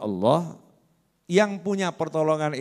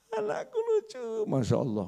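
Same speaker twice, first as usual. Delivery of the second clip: much higher, softer, cooing father on a video call; doting on his child; 8 dB quieter than usual. Retold or imitated. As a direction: coo at the little one like a proud dad. (ayah yang menelepon anaknya dengan suara tinggi dan lembut)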